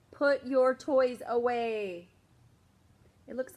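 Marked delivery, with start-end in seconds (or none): 0.1-2.1 slow, loud firm command; stern order. Acted. Mom telling David to tidy up, slow and loud. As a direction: spell it out to the kid.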